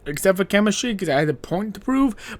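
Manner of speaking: ordinary delivery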